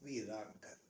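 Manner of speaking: slow, lingering nazm recital, every line weighed; poem recitation. Acted. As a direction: recite the old nazm with a pause after each line. (ठहर-ठहर कर नज़्म पढ़ना)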